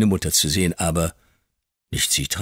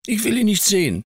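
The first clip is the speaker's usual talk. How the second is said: put-on high, loud boy's refusal; a scared boy digging in his heels; child's frightened protest; defiant child; 5 dB louder than usual.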